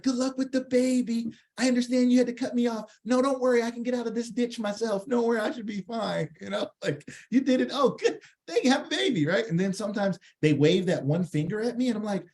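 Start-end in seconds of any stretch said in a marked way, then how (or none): 0.0-6.3 higher-pitched, over-sweet well-wishing; mock goodwill. Joking cheery reply to driver cutting off. 7.3-9.3 high-pitched pretend delight; gushing mock joy; mock congratulations. Mock congratulations to the other driver.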